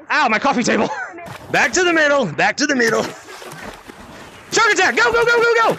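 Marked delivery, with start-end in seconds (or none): 4.5-5.7 high, urgent rallying cry; excited game call. Real, not acted. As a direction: kick off the chase at a high pitch. Launching a round of tag.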